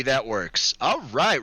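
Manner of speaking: normal talking voice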